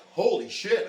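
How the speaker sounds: loud, high burst of disbelief; shocked exclamation. Retold or imitated. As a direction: blurt it out loud as the shock hits. Reliving realizing he got shot.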